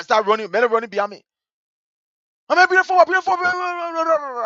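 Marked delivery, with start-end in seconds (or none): 2.5-4.4 high, rapid babble as if fleeing; retold panic, pitched right up; frantic babble. Boy fleeing with his football, frantic babble.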